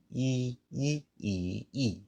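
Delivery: "E" repeated on held level notes; first tone demo. Shown as a demonstration. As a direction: sustain each "E" evenly, as a first tone. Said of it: "I can do my".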